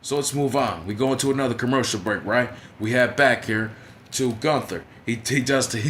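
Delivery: ordinary talk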